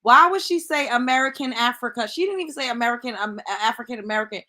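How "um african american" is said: ordinary talk, nothing marked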